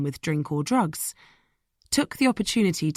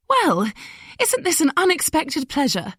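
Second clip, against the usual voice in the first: forced politeness; 9 semitones higher than usual. Acted. Caro forced politeness, caught off guard.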